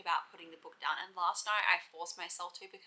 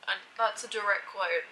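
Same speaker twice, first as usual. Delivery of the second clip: ordinary talk, nothing marked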